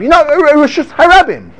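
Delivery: high, stammering mock reply; mock exasperated answer. Study partner answers a question with the same Hebrew term, high and stammering. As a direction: repeat the term in a high, helpless voice. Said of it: "So they'll say".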